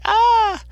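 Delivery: high-pitched held "aah"; imitation scream, sustained and loud; high mock scream. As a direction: squeal out a cartoonish cry of alarm. Imitates Kid Win's scream.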